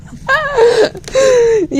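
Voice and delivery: very high, squealing laugh; amused laugh. Real, not acted. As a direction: crack up in a high-pitched giggle. Laughing at the drawn eye.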